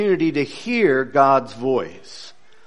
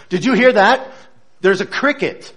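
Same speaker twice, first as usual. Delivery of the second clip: higher and louder, suddenly alert; alerted excitement; 6 semitones higher, 5 dB louder than usual. Acted. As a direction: catch your friend's attention mid-stride.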